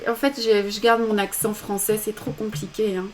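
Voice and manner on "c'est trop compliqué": normal talking voice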